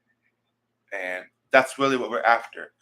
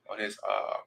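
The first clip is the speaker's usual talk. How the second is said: ordinary talk, nothing marked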